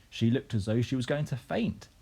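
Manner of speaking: ordinary delivery, nothing marked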